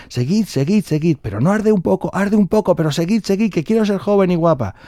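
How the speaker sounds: high-pitched, desperate insistence; strained urging. Acted. Old woman being skinned begs them to continue, high and insistent. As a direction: urge them on through the sting.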